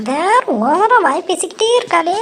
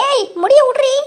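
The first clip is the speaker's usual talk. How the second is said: shout; 8 semitones higher than usual. Acted. Hair-pulling fight, shouting.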